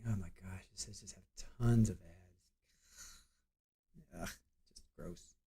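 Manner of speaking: slow whispered grumble to herself; whispered aside. Real, not acted. Grumbling to herself over the website's ads, whispered. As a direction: breathe it out, disgusted. Muttering at the ad-filled site.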